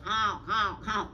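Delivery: slow, drawn-out honks; goose honk. Shown as a demonstration. Storyteller shows how a goose goes, honking. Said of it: "a goose kinda goes".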